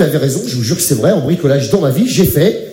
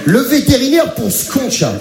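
higher, building list of costs; rising exasperation; 6 semitones higher than usual. Acted. The comic lists costs to the audience, rising exasperated. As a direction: pile the bills up in disbelief.

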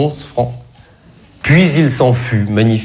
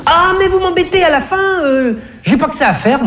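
voix de caissière très aiguë, exaspérée; vendeuse excédée. Acted. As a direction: rembarrer le client en glapissant. (vendeuse glapissante et excédée)

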